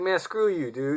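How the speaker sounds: high, loud "screw you" to the driver; defiant comeback. Retold or imitated. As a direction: fling it back at him. Exhausted teen snaps back.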